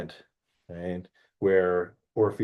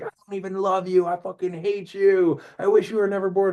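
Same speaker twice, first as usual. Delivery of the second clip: high, rushed imitation of a furious lover; mock hateful outburst; 7 semitones higher than usual. Eurydice's voice taunts Orpheus from behind, high mock fury. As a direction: hurl the insults in a higher put-on voice. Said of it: "all these horrible noises".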